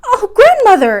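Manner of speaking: high, loud cry of dismay; dismayed exclamation. A narrator's reading. Princess to grandmother, loud high dismayed cry. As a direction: gasp it out as the ball flies into the fire. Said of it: "exclaimed Irene".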